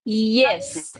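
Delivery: ordinary talk, nothing marked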